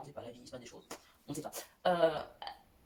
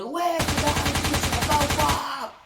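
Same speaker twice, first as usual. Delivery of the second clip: higher, louder exasperated mother telling her off; scolding mother; 6 semitones higher, 7 dB louder than usual. Retold or imitated. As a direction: snap at the kid like an annoyed mom. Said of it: "ma mère qui arrive en mode".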